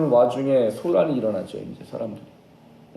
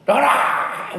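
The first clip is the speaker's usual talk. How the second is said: high, hard, hammed-up shout; mock an over-the-top exorcism yell; yelled command; 13 semitones higher, 5 dB louder, much slower than usual. Preacher asking whether Jesus yelled like this, mock yelled command. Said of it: "예수님 막 소리 질렀을까요".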